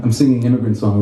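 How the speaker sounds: usual speaking voice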